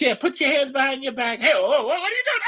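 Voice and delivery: high policewoman voice rising to a panicked shout; mock female cop thrown off. Streamer acting the female cop thrown off the bridge, high panicked scream. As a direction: play the cop going over the bridge, screaming.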